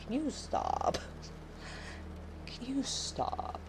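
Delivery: exasperated plea. Retold or imitated. Streamer begging her kid to quit saying six seven, exasperated. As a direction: beg the kid to stop, half laughing. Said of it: "I'm like".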